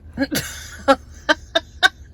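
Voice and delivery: bright laugh up high. Real, not acted. Vlogger laughing about leap year. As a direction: laugh at the thought. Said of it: "the reason why I'm laughing".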